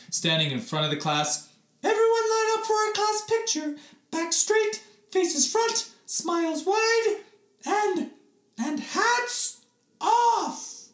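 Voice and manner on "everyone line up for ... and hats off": singsong teacher voice. Acted.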